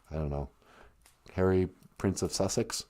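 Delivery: deep fast put-on voice; low put-on voice. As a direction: drop into a low, quick comic voice.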